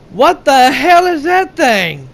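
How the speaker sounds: high, slow, stunned horror; horrified. Retold or imitated. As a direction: gape at the monster, appalled. Soldier sees dragon drop body.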